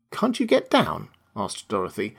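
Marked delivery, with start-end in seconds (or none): 0.1-1.1 put-on high-pitched little girl's question. A narrator's reading. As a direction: ask it high, as a curious girl. Girl voiced in a story.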